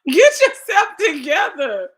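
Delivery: loud, high, drawn-out telling-off; loud mock scolding. Real, not acted. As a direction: scold a weepy friend slowly and loudly. Teasing scolding of tearful friend.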